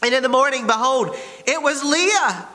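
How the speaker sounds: high-pitched, mounting announcement; dramatic reveal. A narrator's reading. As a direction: build up to the big shocking twist. Dramatic reveal in scripture reading.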